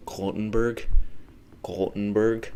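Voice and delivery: "Gothenburg" tried tentatively as KOLT-en-berg; attempted Swedish pronunciation. Shown as a demonstration. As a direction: try a Swedish-style "Gothenburg", first syllable like "colt". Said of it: "pronounce that in the right Swedish pronunciation".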